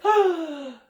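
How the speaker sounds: high, loud gasp coming up from water; big gasp for air. A narrator's reading. Children surfacing from the loch, big loud gasp. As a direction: gulp air after a dive. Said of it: "came back out with a big breath".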